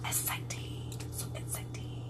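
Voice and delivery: dry sarcastic whisper. Acted. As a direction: breathe it out, deadpan and mocking. Sarcastic aside about cutting vegetables.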